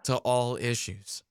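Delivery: loud, measured mock political oratory; Pete Buttigieg. Politician declaring the central issue of democracy, mock gravity. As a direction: pronounce it like a hollow stump speech. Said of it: "very Pete Buttigieg".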